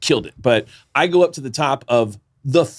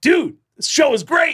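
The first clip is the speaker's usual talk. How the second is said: high, stoked fan shout; excited fan. Retold or imitated. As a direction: gush like a happy metalhead.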